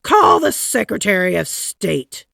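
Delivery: loud commanding call; shouted order. A narrator's reading. President shouting for the Secretary of State. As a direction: bark the order across the house. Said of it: "shouted President Taft".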